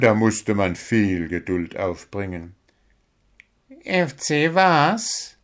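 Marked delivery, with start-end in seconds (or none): 3.8-5.4 slow, high puzzled question; Willi, drowsy. A narrator's reading. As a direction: draw the question out, half-awake. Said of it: "Willi war gerade erst wieder wach geworden".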